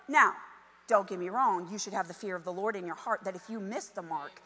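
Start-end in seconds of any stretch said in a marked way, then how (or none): none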